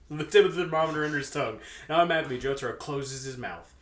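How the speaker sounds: usual speaking voice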